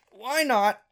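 "Why not" jumped up high and pushed harder. Retold or imitated. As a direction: throw the second "Why not" up high with resolve. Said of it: "I figured".

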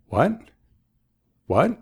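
casual question. Shown as a demonstration. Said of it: "maneras más informales".

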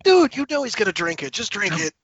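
high-pitched exasperated outburst; exasperated viewer talking at the screen. Acted. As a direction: plead with a dawdling character, pitch jumping up. Mock exasperation at the character.

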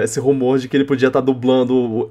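normal talking voice

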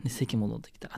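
normal talking voice

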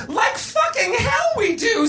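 high, slow shouted refusal; angry refusal. A narrator's reading. Missus Garrett rejects Willis's hospital plan, angry refusal. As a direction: shout down the idea, pitch way up.

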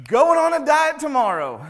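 mock resolution. Chaplain joking about himself to the congregation, hammed-up vow. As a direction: blurt the diet promise as a joke. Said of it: "I'm like".

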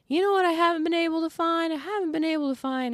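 high-pitched grandpa imitation; high, coaxing old-relative voice; imitated wheedling grandpa. Voicing grandpa hinting for a favor.

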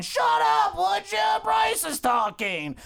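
high, drawn-out angry holler; bellowing boss. Acted. Boss silencing his underling while Bryce talks, yelling. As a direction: shout the interrupter down.

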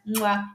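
a quick smacking air kiss; blown kiss. Real, not acted. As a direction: blow the audience a kiss. Blowing a kiss to listeners.

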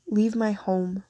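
ordinary delivery, nothing marked

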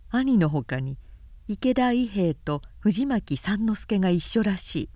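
usual speaking voice

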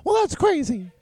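high squeaky cartoon voice; silly high mock tough-guy squeak; Mickey Mouse voice. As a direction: push the pitch way up like a cartoon mouse. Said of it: "in a ridiculous voice".

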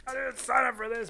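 very high mock outrage; mad listeners, mocked. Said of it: "People really getting mad in the cars".

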